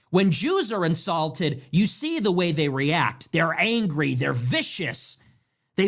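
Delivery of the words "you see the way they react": usual speaking voice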